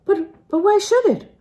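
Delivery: high, flustered stammer; startled. A narrator's reading. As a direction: caught off guard, voice jumping up. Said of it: "She started".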